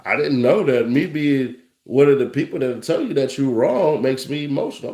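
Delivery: ordinary delivery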